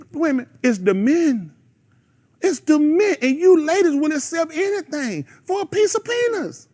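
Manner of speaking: high-pitched pulpit exhortation; impassioned preaching. Real, not acted. Preacher telling women that men dropped the ball, impassioned.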